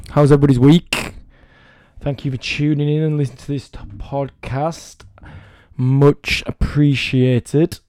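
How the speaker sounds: ordinary talk, nothing marked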